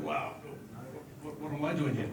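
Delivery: usual speaking voice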